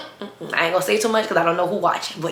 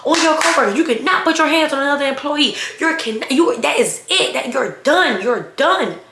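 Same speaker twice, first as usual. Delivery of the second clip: high-pitched, hammed-up scolding; mock outraged coworker; 8 semitones higher than usual. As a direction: imitate a frantic coworker laying down the law. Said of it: "she like".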